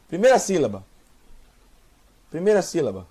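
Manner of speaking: normal talking voice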